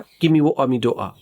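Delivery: mock Scottish accent, t swallowed; playful Scottish accent demo. Shown as a demonstration. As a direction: Give me वो आ with a glottal t, Scottish accent. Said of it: "Scottish accent".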